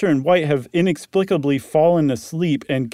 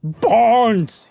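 loud, high call of a name; raised, searching call; 10 semitones higher, 6 dB louder than usual. A narrator's reading. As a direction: shout for a missing friend. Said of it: "he called out".

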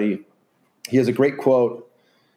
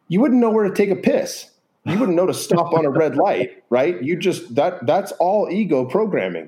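amused high emphasis; 7 semitones higher than usual. Real, not acted. Comic point about ego dissolving.

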